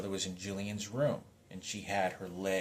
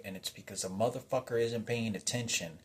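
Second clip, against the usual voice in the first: normal talking voice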